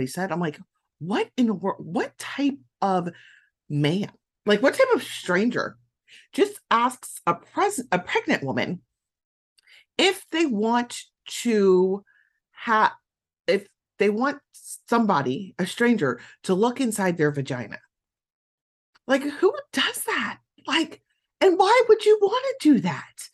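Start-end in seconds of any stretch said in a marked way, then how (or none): 1.0-17.8 incredulous outrage. Retold or imitated. Retelling her own shocked thoughts. 19.1-23.3 high and rising, louder at the end; exasperated disbelief. Real, not acted. Venting to the listener about the stranger, high and exasperated.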